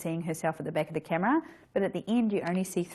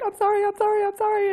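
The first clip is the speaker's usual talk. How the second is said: much higher, squeaky apologetic imitation; mock Dawn apologizing; 13 semitones higher, 4 dB louder, faster than usual. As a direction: tease her for apologizing too much. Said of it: "You're like".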